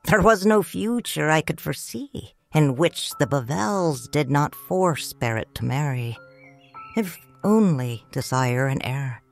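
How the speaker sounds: usual speaking voice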